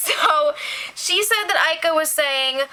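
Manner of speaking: normal talking voice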